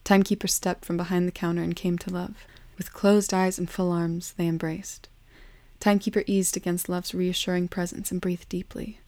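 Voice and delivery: ordinary delivery, nothing marked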